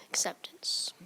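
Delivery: hushed, whispered repeat; whispering. Real, not acted. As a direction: whisper the word under the breath. Speller whispers final repeat.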